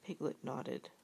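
plain reading voice

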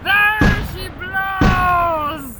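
drawn-out shout. Acted. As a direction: stretch the cry out to the horizon. Repeated, bigger.

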